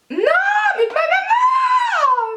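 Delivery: very high, long-drawn wail; shrieking whine. Real, not acted. Vlogger losing patience with lashes, high shrieking whine. Frustrated childish cry for mum.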